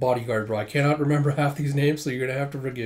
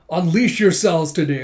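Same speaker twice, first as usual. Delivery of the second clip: louder, higher general's battle cry; rallying order; 4 semitones higher, 7 dB louder than usual. Retold or imitated. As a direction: rouse the army for battle. Retold general rousing his army.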